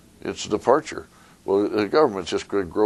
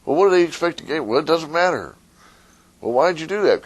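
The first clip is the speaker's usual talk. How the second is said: questioning citizen; 9 semitones higher than usual. Retold or imitated. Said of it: "imagined questions".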